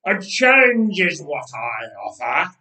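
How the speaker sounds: loud booming giant voice; giant's roar. Acted. Goliath calling across the valley to the army, loud and booming. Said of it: "he would roar".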